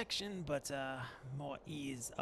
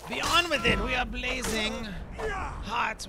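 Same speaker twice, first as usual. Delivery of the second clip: loud rising battle cry; battle shout; 8 semitones higher, 12 dB louder than usual. Real, not acted. Charging the bandits mid-fight, a loud shout. Rushing into battle after potions.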